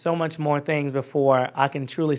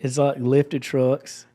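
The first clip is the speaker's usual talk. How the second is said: Georgia accent; much slower than usual. Shown as a demonstration. Guest describing home to the host, Georgia accent. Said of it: "this is my Georgia accent".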